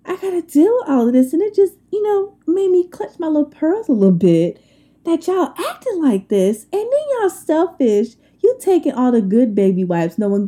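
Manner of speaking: high-pitched and fast, scandalized; indignant rant. Real, not acted. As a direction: clutch your pearls and let it rip. Ranting about stolen baby wipes.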